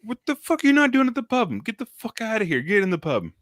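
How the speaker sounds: high, fast, exasperated scolding; mock outraged yell. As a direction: bark it like a drinking buddy who's been stood up. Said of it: "he's like".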